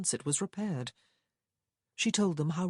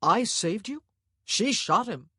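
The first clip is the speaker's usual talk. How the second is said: male character voice; 5 semitones higher, 7 dB louder than usual. A narrator's reading.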